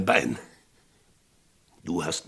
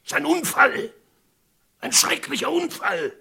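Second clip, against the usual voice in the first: much higher, urgent pleading; desperate pleading; 5 dB louder than usual. Acted. Sheriff begging Tom to call it an accident, desperately. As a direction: beg him to believe it.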